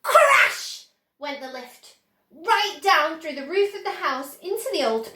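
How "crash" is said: a very high, loud burst on the sound word; dramatic crash. A narrator's reading. Narrator voicing the lift smashing the roof, loud. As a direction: hit the word like an impact.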